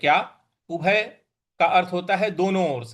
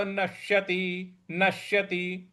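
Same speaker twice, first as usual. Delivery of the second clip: नश्यति drawn out syllable by syllable; slow, careful; 7 dB quieter, slower than usual. Shown as a demonstration. Teacher drilling the श slowly for students. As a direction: stretch नश्यति slowly, stressing the श. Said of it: "आघात देना है".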